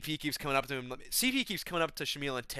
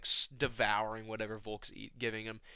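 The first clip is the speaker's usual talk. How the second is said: usual speaking voice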